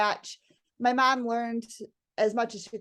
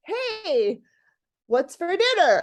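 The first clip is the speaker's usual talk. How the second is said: much higher, louder, cheery voice for the husband; husband's breezy ask; 7 semitones higher, 5 dB louder, slower than usual. Retold or imitated. Husband strolling in asking wife about dinner, high and cheery. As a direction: sing it out like a carefree husband strolling in.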